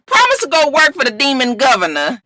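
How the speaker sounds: usual speaking voice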